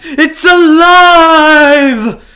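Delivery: a stretched-out cry building to a full shout; mad scientist quote. Acted. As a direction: howl it like a triumphant mad scientist. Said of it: "what book that quote I got from".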